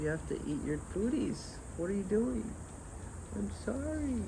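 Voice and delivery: high sing-song pleading with a pet. Real, not acted. Coaxing dog to eat food.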